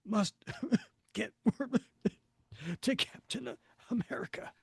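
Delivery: coughing and wheezing through words; smoke inhalation. Acted. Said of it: "he's coughing and wheezing".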